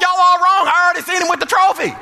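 loud, high retold holler at the television; laughing shout at the commentators. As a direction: yell it at the screen like a know-it-all fan. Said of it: "I was hollering at the T V".

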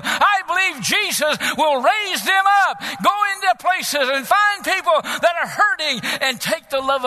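high, loud rallying sermon shout; full-force preaching. Acted. Preacher rallying the church to go out, full-force shouted preaching. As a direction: punch it out in rising revival cadence.

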